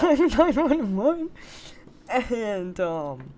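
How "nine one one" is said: higher, laughing mock panic; laughing alarm. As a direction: crack up while faking a call for help. Joking emergency reaction while laughing.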